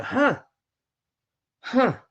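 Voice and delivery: short high-pitched "huh" sounds; high, punchy "huh" noises; mimed effort "huh". Retold or imitated. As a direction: imitate little high bursts as you tap. Acting out tapping the phone.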